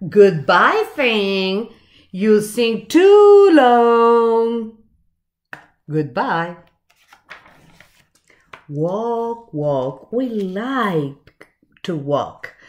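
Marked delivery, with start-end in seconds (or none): none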